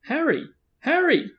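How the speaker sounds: high-pitched calling out; high, excited yell, falling at the end; yelled name. A narrator's reading. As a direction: call across the street to a friend. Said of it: "someone yelled his name".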